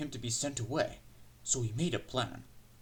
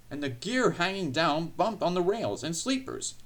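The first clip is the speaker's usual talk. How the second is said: plain reading voice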